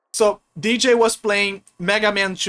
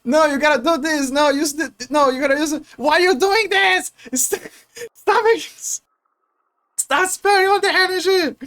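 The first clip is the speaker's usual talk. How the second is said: imitating yelling; 6 semitones higher than usual. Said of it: "Blaze was, like, telling".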